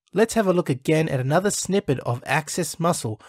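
ordinary talk

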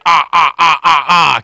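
loud yelps of pain; pained cries. Retold or imitated. Dad stepping on toys, loud yelps of pain. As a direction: yelp as if stepping on something sharp. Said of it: "He was like".

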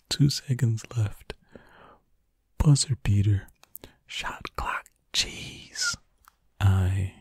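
hushed running commentary; ASMR whisper. Acted. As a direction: whisper close and intimate while solving. Whispered ASMR puzzle play.

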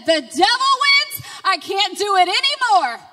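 high, loud cry of defeat; anguished cry. Retold or imitated. After throwing the Mountain Dew, she cries out loudly in defeat. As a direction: shout it in surrender. Retold breakdown, threw the bottle.

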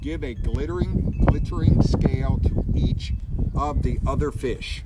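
storybook reading voice; reading to children. A narrator's reading. Reads picture book aloud.

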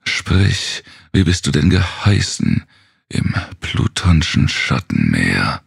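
plain reading voice

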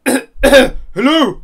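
booming, too-loud greeting; loud hello. Acted. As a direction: blurt it out loud by mistake. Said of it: "sorry, whisper".

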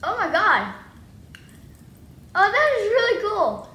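loud, thrilled outburst; excited shouting. Real, not acted. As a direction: shout in sudden delight. First Pop Rocks popping in mouth.

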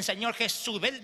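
ordinary delivery

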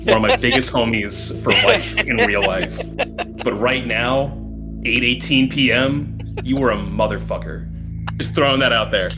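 normal talking voice

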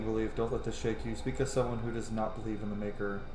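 usual speaking voice

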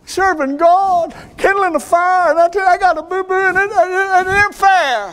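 high, whining complaint about a boo-boo; mock self-pity, high and far from his own voice; whiny hurt believer. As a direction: whimper it like a wounded child. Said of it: "because you say".

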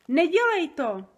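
loud, high protest; pained yell. A narrator's reading. Giant begs Sofie in his ear to stop, yelling. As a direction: yell it as if it hurt. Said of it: "zaječel Obrdobr".

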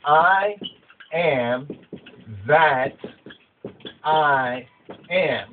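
each word of "I am that I am" set apart by long pauses; deliberate reading, a pause after every word; slow word by word. Shown as a demonstration. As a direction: space out "I am that I am" one word at a time. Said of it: "let's examine this right here".

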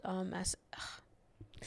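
trails into a whispered, strangled ugh; whispered choke. Real, not acted. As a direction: let the words die in a whisper. Choking up mid-sentence.

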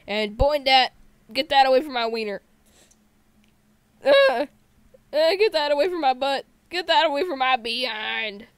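loud, frantic pleading at a zombie; panicked yell. Real, not acted. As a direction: shout it as something grabs at you. Panicked at zombie behind him.

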